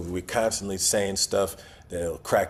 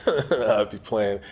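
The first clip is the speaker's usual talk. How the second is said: slow words through a chuckle; laughing; much slower than usual. Real, not acted. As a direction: crack up while trying to go on. Cracks up at his own idea.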